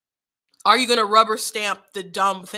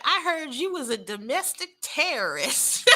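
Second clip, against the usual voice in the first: put-on mom's country Detroit accent, laughing, pitched higher; 5 semitones higher than usual. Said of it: "like, country Detroit accent".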